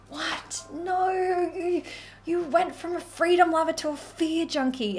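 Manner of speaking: high, disbelieving squeal; incredulous disbelief. Retold or imitated. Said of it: "And I'm like".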